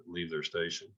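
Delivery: normal talking voice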